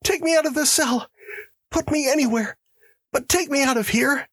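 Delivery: burst of terror. Acted. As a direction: blurt it out in sudden panic. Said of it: "in a sudden burst of terror".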